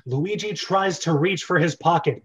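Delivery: ordinary talk, nothing marked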